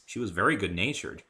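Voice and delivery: plain reading voice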